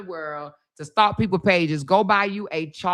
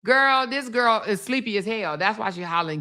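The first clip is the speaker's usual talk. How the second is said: ordinary delivery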